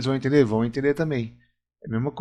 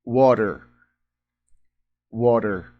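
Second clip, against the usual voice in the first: American "Water" with the t softened to a quick tap and an r at the end; "Water" as an American, WAH-der; American accent; 2 semitones lower, much slower than usual. Shown as a demonstration. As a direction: say "Water" the American way, tapped t, r-coloured ending. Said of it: "o sotaque americano é mais parecido com isso".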